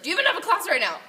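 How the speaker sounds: high, loud suspicious question; Plummer scolding. Retold or imitated. Coach Plummer questioning Tessa about class, high and loud. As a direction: call her out loudly as a suspicious coach. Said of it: "And then he's like".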